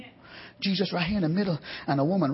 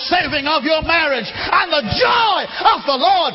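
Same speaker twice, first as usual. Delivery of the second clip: higher, louder shouted preaching climax; climactic preaching; 7 semitones higher, 6 dB louder than usual. Acted. Preacher urging congregation to press in, loud high shouted climax. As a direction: hit the peak and hold it.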